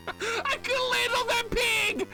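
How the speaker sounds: slow, very high laughing yell; hysterical squeal. Real, not acted. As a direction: shriek it through helpless laughter. Fails jump, shrieks laughing.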